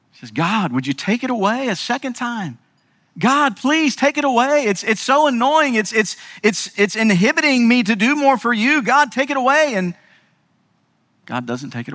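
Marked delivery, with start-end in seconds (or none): 0.3-9.7 high, urgent pleading to God; frustrated plea, pitched high; pleading prayer. Acted. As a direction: beg God to take it away.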